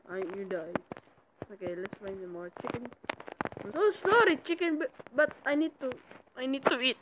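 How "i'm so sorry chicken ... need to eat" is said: mock apology. Real, not acted. Apologizing to chicken before kill.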